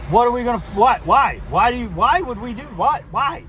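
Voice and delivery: high, loud, bewildered why; baffled pleading. Retold or imitated. To his drunk girlfriend, a high baffled plea. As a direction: protest in disbelief, voice jumping up. Confused by her threat to fight.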